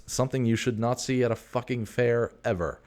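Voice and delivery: normal talking voice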